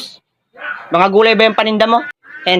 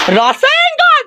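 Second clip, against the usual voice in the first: anime attack cry; much slower than usual. Acted.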